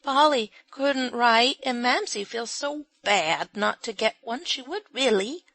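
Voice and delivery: put-on high, earnest child's voice; a small child explaining seriously; little girl voice. A narrator's reading.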